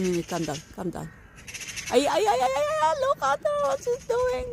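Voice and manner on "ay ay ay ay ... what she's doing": much higher, panicky cries; alarmed squeal. Real, not acted. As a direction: squeal in alarm as the cat climbs. Cat climbs higher, owner alarmed.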